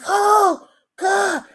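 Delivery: high cry of shock. Retold or imitated. Aghast at cousin slamming the girl through the table. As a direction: gasp out oh God. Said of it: "I was like".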